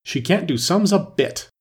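"She can't do sums a bit" high and emphatic; "can't do sums a bit" in firm, high-pitched emphasis; great emphasis. A narrator's reading. Two Queens judging Alice, "She can't do sums a bit" emphatic. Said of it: "said together with great emphasis".

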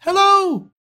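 put-on very high young boy; boy's voice. A narrator's reading. Boy answering Scrooge from below, high boyish voice. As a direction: pipe up, astonished. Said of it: "returned the boy".

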